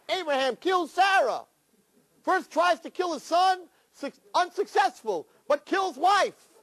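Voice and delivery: high, slow tabloid announcer; mock headline. Mock tabloid blaring Abraham kills Sarah, high and slow. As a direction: hawk it like a scandal headline.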